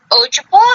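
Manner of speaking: "Ojibwa" as a tentative question, pitch rising; unsure pronunciation. Real, not acted. Reader stumbling over a tribe's name, unsure pronunciation. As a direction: try "Ojibwa" with a questioning lift. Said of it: "That how it's pronounced?".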